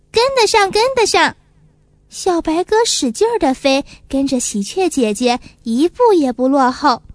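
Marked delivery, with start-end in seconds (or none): 0.1-1.3 high, eager little dove repeating; a small bird eagerly promising it can keep pace; eager insisting. A narrator's reading.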